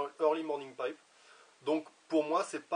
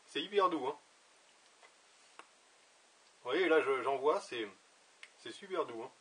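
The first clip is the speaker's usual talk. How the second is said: talking around pipe stem; clenched teeth speech; pipe in mouth. Real, not acted. Seen 2: Pipe stem clenched in his teeth the whole time he speaks.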